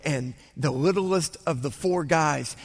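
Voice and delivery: ordinary talk, nothing marked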